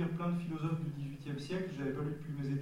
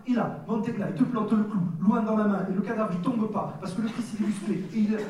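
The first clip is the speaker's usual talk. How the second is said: loud, higher, punchy Niçois sports-commentator delivery; forced Niçois radio accent; 6 semitones higher, 8 dB louder than usual. Shown as a demonstration. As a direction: sell the painting like a Nice match commentator, thick Niçois accent. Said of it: "l'accent, il me vient à la radio. Je le force un peu".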